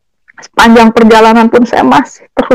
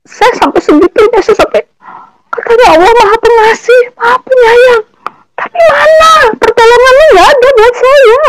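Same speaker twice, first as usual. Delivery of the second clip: crying shout, much higher; wailing accusation at God, voice cracking; tearful outburst; 12 semitones higher than usual. Real, not acted. Mother reliving her fury at God, crying shout. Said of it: "saya ngoceh, saya marah".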